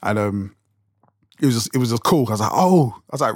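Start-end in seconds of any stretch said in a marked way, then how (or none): 2.5-2.9 higher-pitched "oh" of dawning understanding; sudden realization. Retold or imitated. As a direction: retell the moment it clicks. Acting out his sudden realization.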